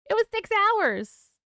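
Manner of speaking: high, loud, drawn-out amazement; amazed, slow. Real, not acted. Author amazed a viewer heard all six hours, high and slow. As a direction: stretch it out in disbelief. Surprised viewer listened whole book.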